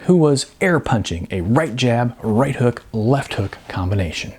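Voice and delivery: usual speaking voice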